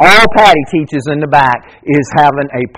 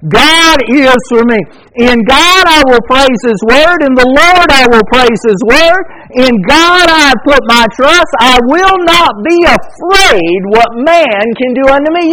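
raised, ringing reading of a psalm; scripture reading; 9 semitones higher, 4 dB louder than usual. Acted. Preacher reading a psalm to his congregation, ringing proclamation. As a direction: proclaim the verse to the church.

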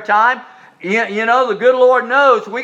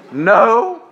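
normal talking voice

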